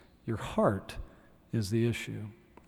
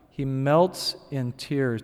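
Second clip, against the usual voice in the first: normal talking voice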